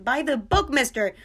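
hammed-up cop telling her listeners to go by the book; mock detective yell. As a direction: play a hard-nosed detective laying down the law, half in jest. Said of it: "like the detectives yell".

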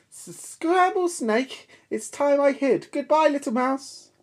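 very high, stammering panic; frightened snake. Acted. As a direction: stammer and flee.

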